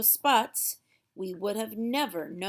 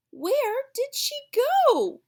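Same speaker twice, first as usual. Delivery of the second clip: much higher, louder, slow worried call; alarmed cry; 9 dB louder, slower than usual. Acted. Girl waking to find her unicorn gone, high loud worried call.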